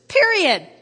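high, loud "period"; forceful full stop; emphatic declaration. Real, not acted. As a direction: punch it as the last word. Closing the verse again.

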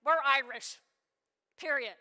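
a loud gruff dad's bark; loud, grumbling dad voice. Retold or imitated. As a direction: bark it like a grumpy father shutting down the question. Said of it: "he kinda grumbled and barked".

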